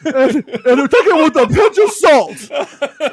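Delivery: high, loud mock reporter, laughing. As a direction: parody a smug rumor reporter. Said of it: "who talks like that?".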